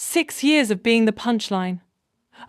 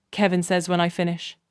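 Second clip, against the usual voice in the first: ordinary delivery, nothing marked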